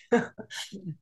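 short soft chuckle; real little laugh at oneself. Real, not acted. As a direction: let out a small, quiet laugh. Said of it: "see, I'm laughing right now".

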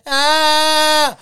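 high, loud imitated cry; loud mock "Ah!". Telling how his girlfriend reacts, loud mock outburst.

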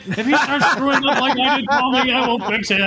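put-on the proud tough dad promising to straighten the kid out; hearty mock shout with laughter. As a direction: lumberjack father booming to the teacher about his son. Big ex-student shaking his son.

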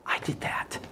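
breathy hushed whisper, close and intimate; whispered voice of God. Retold or imitated. God whispering to the young man in the car. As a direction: let God's words fall to a whisper. God speaking softly to him.

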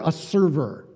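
ordinary delivery

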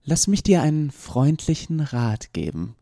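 freundlichen Rat pressed hard like a cruel threat; stress on freundlichen Rat. Acted. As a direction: lean menacingly on freundlichen Rat. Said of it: "Sie betonte freundlichen Rat".

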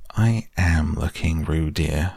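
plain reading voice

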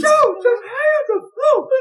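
very high, loud mock wail; exasperated mom. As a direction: imitate a mother yelling at her husband. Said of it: "My mom is going".

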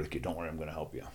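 hushed, low confidential aside; quietly reassure, quick and very soft; imitated secret whisper to a kid; retold whisper. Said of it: "and then I whisper".

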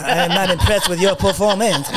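mock Belgian action-star accent, laughing; lightly put-on Van Damme accent; a hint of a Belgian-French accent, half laughing; Van Damme impression. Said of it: "he does sound better than Jean-Claude".

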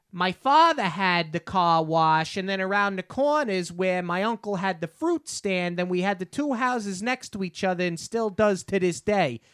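imitating a thick family New York accent; old New York accent. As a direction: talk like an old-neighborhood New Yorker. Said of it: "family that is exactly that".

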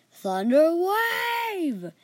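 slow, loud, high spell call; spell shout. Acted. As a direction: bellow the spell slowly like casting magic. Elf casts a spell.

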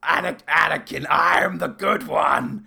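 slow, loud, overacted villain claim; hammed-up villain plea. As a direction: ham up the evil emperor's pitch. Mock Palpatine persuading Anakin.

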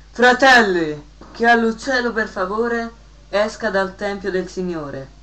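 parroco dal pulpito. Acted. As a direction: address the flock from the pulpit, starting with a big projected cry. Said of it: "s'arrampicò sul pulpito e poi fece". (predica ad alta voce dal pulpito)

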